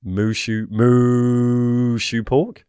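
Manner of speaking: lowing cow drawn out into a question. Pretend. Punning for his cohost, a slow mooing moo shoe pork. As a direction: moo it slowly, rising at the end.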